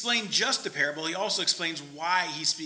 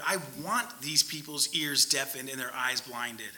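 ordinary talk

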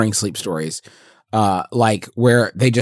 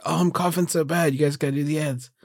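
fake sick voice; 8 dB quieter than usual. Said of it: "I did the whole, like, ... thing".

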